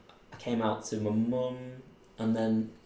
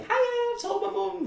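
much higher bubbly greeting; excited hiya; 4 dB louder than usual. Retold or imitated. Greeting Robin at home, high and excited. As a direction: sing it out, bursting with news.

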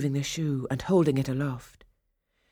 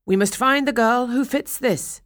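proclaiming; 8 semitones higher, 6 dB louder than usual. Acted. Messengers proclaim at the door.

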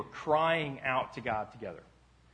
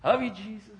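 high, held-back call; timid high-pitched holler; failed attempt at shouting; 9 semitones higher, 4 dB louder than usual. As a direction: try to yell and not manage it. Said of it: "I was having trouble yelling".

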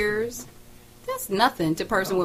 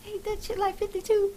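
shocked; 12 semitones higher, 6 dB quieter than usual. Retold or imitated. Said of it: "I was like".

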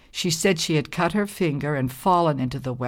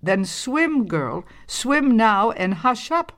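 firm scolding; 6 semitones higher, slower than usual. Acted. Old woman scolding.

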